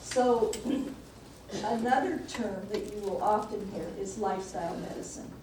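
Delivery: usual speaking voice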